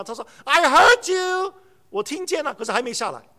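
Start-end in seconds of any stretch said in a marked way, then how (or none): 0.4-1.5 put-on higher, slow, drawn-out teen answer; mock teen reply. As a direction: play the lazy son. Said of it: "他回应了".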